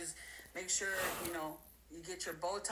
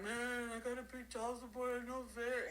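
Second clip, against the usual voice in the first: mock crying; 6 semitones higher than usual. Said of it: "And they're crying".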